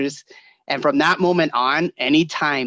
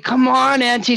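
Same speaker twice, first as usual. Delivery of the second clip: high, louder whine; pitched-up impatient plea; impatient kid; 10 semitones higher, 4 dB louder than usual. Retold or imitated. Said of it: "you'd be like".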